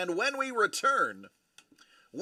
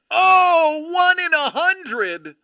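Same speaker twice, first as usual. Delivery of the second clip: loud, high, drawn-out amazement; thrilled, high-pitched exclamation; excited outburst; 9 semitones higher, 9 dB louder, slower than usual. Real, not acted. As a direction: burst out loud and slow at a rare find. Reacts to a rare hit.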